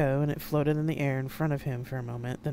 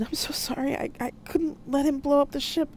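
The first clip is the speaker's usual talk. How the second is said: much higher, pleading voice; distraught apology; 12 semitones higher, 5 dB louder than usual. A narrator's reading. Aide apologises to the Admiral for stabbing through him, distraught. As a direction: apologise in high, stumbling distress.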